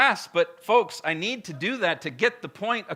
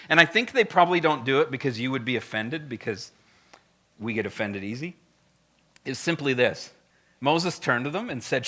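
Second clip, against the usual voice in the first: normal talking voice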